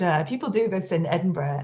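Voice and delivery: usual speaking voice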